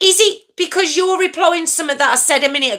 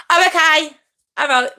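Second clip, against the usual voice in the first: ordinary talk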